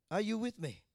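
ordinary delivery

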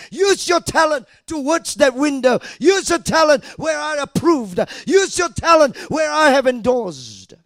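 booming, loud voice of God; a hint of a divine command; voicing the Lord. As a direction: thunder the Lord's command. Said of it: "And he says".

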